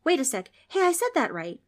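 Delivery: put-on high, nervous kid; high-pitched frightened Frank; nervous boy. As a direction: stammer it out scared. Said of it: "nervous and scared".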